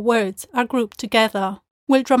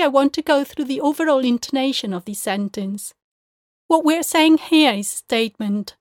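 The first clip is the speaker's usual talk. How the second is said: ordinary talk, nothing marked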